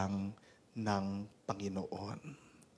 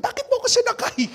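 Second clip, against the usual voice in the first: put-on high, loud, fast outraged old man's yell; angry old man; 12 dB louder, much faster than usual. Old man yelling at the conductor, furious and high. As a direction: shout it like a furious old man thrown back on the bus. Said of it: "Galit na galit yung matanda".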